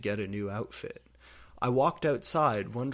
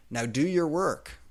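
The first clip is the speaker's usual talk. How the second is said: stern order; 6 semitones higher, 5 dB louder than usual. Acted. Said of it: "teacher commanding".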